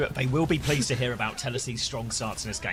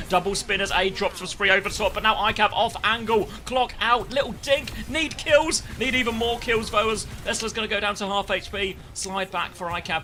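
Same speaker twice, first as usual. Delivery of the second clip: rising, louder play-by-play call; excited play-by-play; 7 semitones higher, 4 dB louder than usual. Acted. Caster calling a tense fight, getting excited. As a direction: build the call as the fight heats up.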